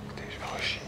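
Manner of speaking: urgent hushed whisper. Real, not acted. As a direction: whisper it while sneaking. Sneaking near the guards.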